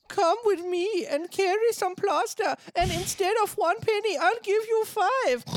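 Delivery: very high, loud German piggy bricklayer; camp German pig. Acted. Bricklayer offering the beggar work, camp German pig voice. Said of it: "So really camp, offensive German oink".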